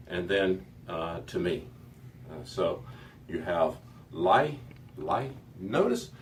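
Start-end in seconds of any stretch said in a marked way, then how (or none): 4.1-5.3 "lai" with a slight glide into I at the end; slight I sound. Shown as a demonstration. Teacher modelling the ending for students, pronouncing carefully. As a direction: finish "lai" on a light "ee" glide. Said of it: "notice the very slight I sound".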